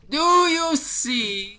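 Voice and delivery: very slow, high, loud ghostly wail; slow ghostly dwarf. Acted. Ethereal dwarf points across the pit, slow high wail. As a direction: draw each word out in a high spectral voice. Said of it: "He speaks slow and almost lifeless".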